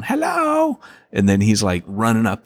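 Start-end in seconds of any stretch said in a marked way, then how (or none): 0.0-0.8 very high, drawn-out call; a little kid calling down from upstairs; high-pitched toddler holler; imitating small child. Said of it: "at the top of the stairs going".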